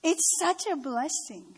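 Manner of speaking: ordinary delivery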